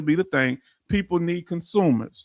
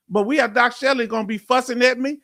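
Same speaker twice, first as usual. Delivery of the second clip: ordinary talk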